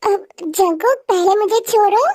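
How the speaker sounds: ordinary talk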